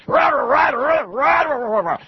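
high, fast mock rant of a TV demagogue; a hammed-up nationalist tirade; mock ranting politician. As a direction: pound the table and rant like the man on TV. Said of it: "like that".

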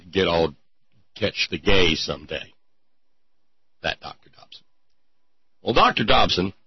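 ordinary talk

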